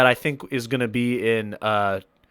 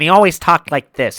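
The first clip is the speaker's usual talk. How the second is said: nasal Frank voice, louder; nasally voice; 4 semitones higher, 6 dB louder than usual. Shown as a demonstration. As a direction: talk through the nose. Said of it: "He had, like, a very nasally voice".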